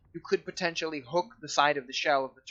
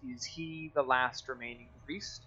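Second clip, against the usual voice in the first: slow, softer turtle question; lightly voiced turtle, taking its time; 3 semitones lower, 9 dB quieter than usual. Turtle asking party about the priest, slow and soft. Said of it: "he says".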